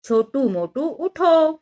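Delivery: narrated motherly wake-up call; calling out loudly. A narrator's reading. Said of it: "तभी माँ ने जोर से पुकारा".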